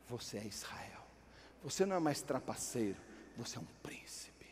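God renaming Jacob in a deep whisper; hushed divine voice. Acted. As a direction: speak the blessing like a sacred secret.

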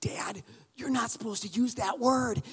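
slow, scandalized whisper; hushed, drawn-out little-kid reproach; shocked child whisper. Retold or imitated. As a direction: whisper it like a child telling Dad off. Shocked child scolds dad, retold.

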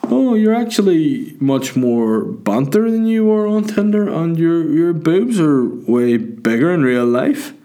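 high, slow, exaggerated punter voice. Drunk fella chatting up women, high slow put-on voice. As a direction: mimic a clumsy drunk chat-up, drawn out. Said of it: "whenever he's chatting up women".

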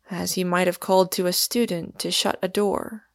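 usual speaking voice

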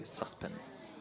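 suspense whispered, no voice, teasing. Shown as a demonstration. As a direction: hush the word suspense like a secret.

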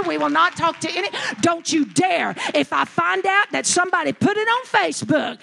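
loud, forceful preaching command; fierce declaration. Retold or imitated. Sister-in-law commands the weeping family, fierce loud declaration. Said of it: "And she says".

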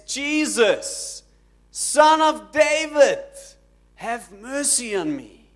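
loud, high, drawn-out cry for mercy; acted-out beggar's shout; screaming plea. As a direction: call out desperately, stretching the words, then ease off. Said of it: "he starts screaming".

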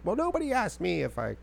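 a touch of an offended grandma. Retold or imitated. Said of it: "She's like".

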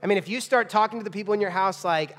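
ordinary delivery

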